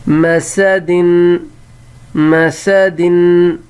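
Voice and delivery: مسدن drawn out, ma-sa-din; slow model reading. Shown as a demonstration. Teacher to pupils, drawn-out model word. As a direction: sound out مسدن slowly. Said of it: "اگلی line".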